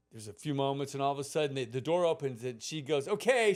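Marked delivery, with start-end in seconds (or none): 3.1-3.5 high, loud imitated call. Babysitter summons boy from the door, high calling voice. Babysitter calls child back inside.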